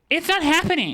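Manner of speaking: high and loud, shutting it down; firm refusal. Retold or imitated. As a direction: slam the door on it. Flatly refusing creepy client.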